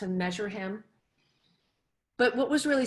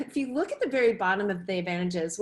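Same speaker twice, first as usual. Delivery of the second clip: ordinary delivery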